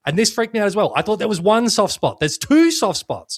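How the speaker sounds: high, alarmed disbelief; freaked out. Real, not acted. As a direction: sound freaked out. Freaked out about two spots.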